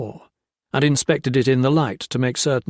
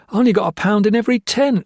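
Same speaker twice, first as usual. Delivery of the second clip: frantic excuse; 10 semitones higher, 5 dB louder, faster than usual. Acted. Blakely pleads excuses.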